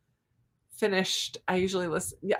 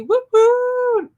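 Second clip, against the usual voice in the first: much higher, loud celebratory "woo woo"; excited cheer; 8 dB louder than usual. Real, not acted. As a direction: cheer them on like a fan. Cheering a viewer's finished book.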